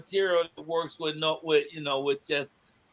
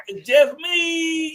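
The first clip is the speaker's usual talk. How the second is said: high strained punchline; 9 semitones higher, 4 dB louder, much slower than usual. Retold or imitated.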